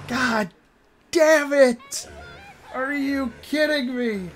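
raging at the game; frustrated shout. Real, not acted. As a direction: yell at the bad luck. Game rage.

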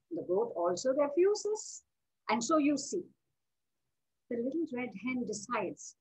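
usual speaking voice

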